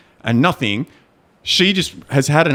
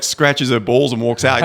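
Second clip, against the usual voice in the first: ordinary delivery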